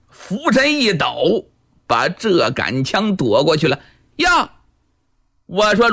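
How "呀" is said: sudden high squeak of alarm; startled high yelp. Acted. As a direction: yelp as the spear just misses. Nun dodges the spear.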